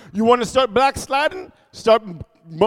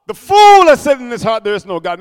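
loud proclamation; 3 semitones higher, 11 dB louder than usual. Acted. Pastor to congregation, loud proclaimed verse. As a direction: blast the verse out.

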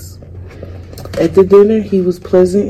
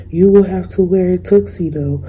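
usual speaking voice

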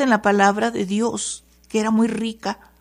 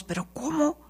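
much higher, much softer, a disbelieving voice; incredulidad; 5 semitones higher, 8 dB quieter than usual. Pretend. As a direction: gasp it out in disbelief. Congregation voiced doubting God's word. (con incredulidad, mucho más agudo y suave)

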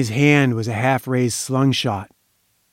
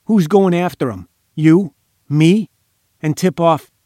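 higher, louder indignant questions; growling; 5 semitones higher, 5 dB louder than usual. A narrator's reading. Henchman objecting to the boss's plan, with a growl. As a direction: push each short question out, mildly growled. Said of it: "he growled".

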